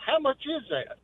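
ordinary delivery

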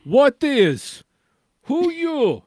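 high, loud broken English voice; squeaky voice of a confused old foreigner; mock broken English. Mock non-English speaker voice.

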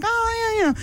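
high-pitched imitated exclamation; mock relieved reaction. Retelling strangers' response to the explanation, mock relief.

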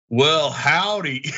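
put-on slow cowboy-style American accent; drawn-out mock Western accent. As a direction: imitate tourists doing a John Wayne American. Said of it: "do an American accent".

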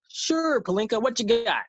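high-pitched GM reply; Tommy Shepard voice. Retold or imitated. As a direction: raise the pitch for the other GM. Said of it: "if I was Tommy Shepard".

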